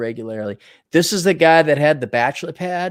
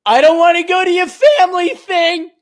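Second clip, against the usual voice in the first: much higher, louder hammed-up yell; mock shouted refusal; 14 semitones higher, 6 dB louder than usual. Streamer hamming up his own yelled refusal to girlfriend. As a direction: bellow the refusal like a brat. Acted refusal in retold quarrel.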